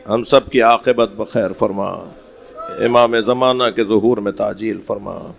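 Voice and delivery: low, hushed supplication; closing prayer. Acted. Preacher closing the majlis with a quiet prayer for the mourners. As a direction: pray softly after the lament.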